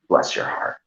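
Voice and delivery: hushed whisper. Acted. Host warns Poon in a whisper not to say it. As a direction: warn in a whisper.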